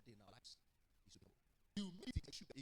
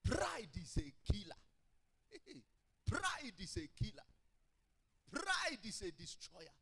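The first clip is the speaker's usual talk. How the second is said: loud, drawn-out hit on each "Pride"; emphatic preaching warning; 4 semitones higher, 9 dB louder, slower than usual. Real, not acted. Preacher warns the people about pride, loud and drawn out. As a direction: proclaim it slowly, punching the first word hard.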